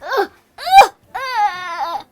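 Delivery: high, loud strangled cries; mock choking under snakes; choking grunts. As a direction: gag as snakes wrap your face. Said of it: "And then he's like".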